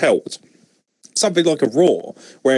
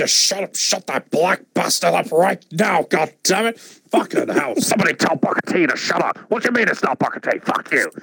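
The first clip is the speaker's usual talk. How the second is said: higher put-on angry boss voice; Vince McMahon; 4 semitones higher than usual. Vince ranting in the headset, higher mock voice. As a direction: rant it in a raised mock boss voice. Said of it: "Vince McMahon's just in his".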